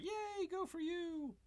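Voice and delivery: high, slow, soft mock-cheerful lady; mock lady cheering, high and slow; lady's voice. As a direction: squeak it out like a frail cheerleader. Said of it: "The lady's like".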